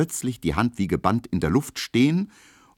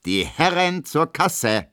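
dancing master's call; 10 semitones higher, 3 dB louder, slower than usual. A narrator's reading.

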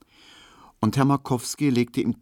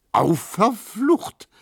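drawn-out, high, loud oath; dismayed, high exclamation; cursing cry; 6 dB louder, much slower than usual. A narrator's reading. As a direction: yelp the curse slowly. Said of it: "rief Neumann".

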